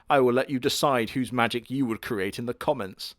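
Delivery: normal talking voice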